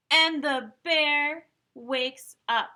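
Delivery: loud, slow dramatic reveal; dramatic announcement. A narrator's reading. Climax of story, loud slow.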